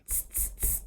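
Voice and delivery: voiceless repeated monkey tsk; repeated hissy "tss" like teasing monkeys; little teasing monkey noises; monkey chatter. A narrator's reading.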